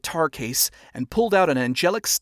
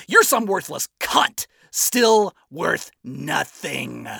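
loud, hateful rock star sneer; seething hate; 3 semitones higher, 3 dB louder than usual. Acted. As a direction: spit the insults with contempt. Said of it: "Adam seethed in pure hate".

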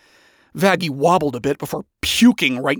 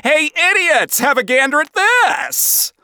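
showy call-out; 11 semitones higher than usual. Acted. Lucifer to two degenerates at a concert, showy call-out. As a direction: call out to grab their attention.

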